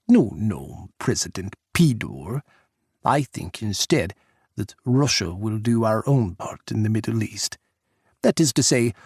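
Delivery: put-on firm Russian-accented declaration; a touch of a proud Russian leader; Russian president dismissing Jack, Russian accent. Pukov's accented voice.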